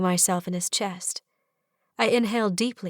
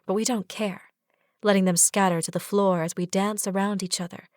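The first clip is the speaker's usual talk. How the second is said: ordinary delivery, nothing marked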